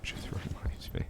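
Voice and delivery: voiceless whispered aside. Real, not acted. To friends about the girl, a private whisper. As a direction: breathe it under your breath.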